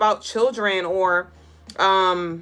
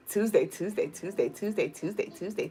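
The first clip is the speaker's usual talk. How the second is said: very soft breathy chant; Norbit whispering; 2 semitones lower, 10 dB quieter than usual. Retold or imitated. Streamer quoting Norbit softly chanting the day. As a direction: hush it like a nervous crush. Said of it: "he was like".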